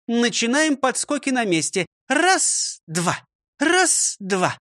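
high, brisk commands and counts; drill teacher counting. Acted. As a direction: call out the drill. Teacher leads exercise drill. (командует зарядкой)

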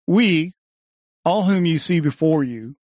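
usual speaking voice

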